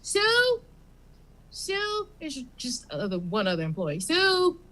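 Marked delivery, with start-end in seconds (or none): 0.0-2.1 Bob the clerk. Acted. Bob checking with his coworker. 4.0-4.6 Bob calling Sue. Acted.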